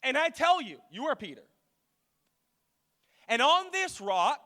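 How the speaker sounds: loud, high proclamation; weighty preacher's declaration; emphatic scripture reading. Real, not acted. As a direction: proclaim the verse to the whole church. Reads scripture aloud with force.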